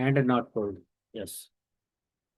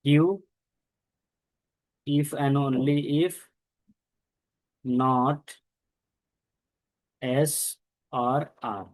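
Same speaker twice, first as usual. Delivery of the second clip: slow spelled-out phrase; much slower than usual. Shown as a demonstration. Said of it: "teacher spells out the connective".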